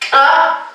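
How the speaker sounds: "ka" with a sharp popped k; reinforced ejective consonant. Shown as a demonstration. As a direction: pop the k, then release the "a" of "ka". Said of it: "Consonantes reforzadas". (consonante reforzada, "ka" con golpe glotal)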